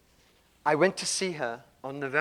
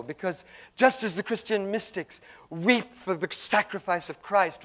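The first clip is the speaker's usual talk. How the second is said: ordinary delivery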